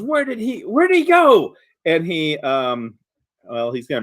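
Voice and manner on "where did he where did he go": high frantic yell. Acted. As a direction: shout it out in panic. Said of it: "he yells".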